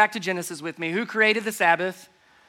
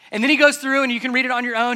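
ordinary talk

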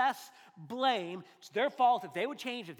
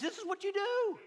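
much higher, mock-incredulous retort; a playful Jesus rebuke in a put-on high voice; Jesus voicing; 10 semitones higher than usual. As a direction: pitch it way up as a teasing Jesus. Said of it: "And he goes".

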